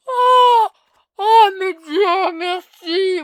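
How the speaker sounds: high, loud cry of pure joy; ecstatic shout. Real, not acted. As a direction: yell your thanks to the heavens. Finds the fortress at last.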